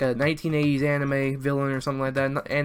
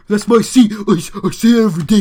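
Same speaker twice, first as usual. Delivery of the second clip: high, loud, ridiculous mocking voice; exaggerated mimicry of a whining rival; insulting imitation; 6 semitones higher, 9 dB louder than usual. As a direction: make him sound stupid, high and loud. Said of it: "I'm gonna make him sound like that just to insult him".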